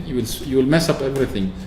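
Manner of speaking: usual speaking voice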